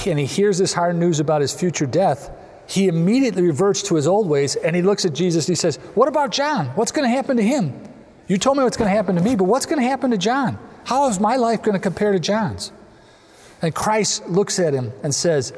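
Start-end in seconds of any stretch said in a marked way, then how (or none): 5.9-12.7 higher-pitched, hurried protest; indignant questioning. Retold or imitated. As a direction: press anxious questions as an aggrieved disciple. Voicing Peter's challenge to Jesus.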